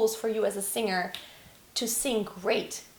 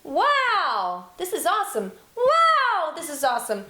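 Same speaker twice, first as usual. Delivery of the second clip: bright excited exclamations, higher and louder; excited wow; 6 semitones higher, 5 dB louder than usual. Shown as a demonstration. Teacher demonstrating a belting exclamation, excited. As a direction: shout it out thrilled, not pushed. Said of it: "It's more like a".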